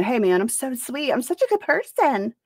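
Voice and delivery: high-pitched sugary mimicry; hammed-up "I'm so sweet" voice; mock self-proclaimed sweetheart. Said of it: "go around saying".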